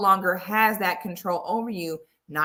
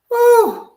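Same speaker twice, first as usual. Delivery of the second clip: loud, high "woo" to let off alarm; sudden high-pitched cry of relief; shrill burst of shaken nerves; agitated whoop; 11 dB louder than usual. Real, not acted. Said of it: "let me calm down for a second".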